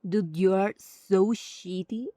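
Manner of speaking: ordinary talk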